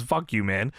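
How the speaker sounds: ordinary delivery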